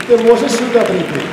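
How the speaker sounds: usual speaking voice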